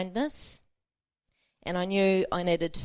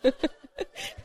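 breathless silent laughter; airy laugh; 8 semitones higher than usual. Real, not acted. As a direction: let the laugh leak out. Laughing at her own joke.

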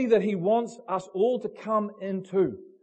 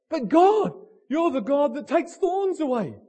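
whiny protest; 6 semitones higher than usual. Acted. Paul protesting to God.

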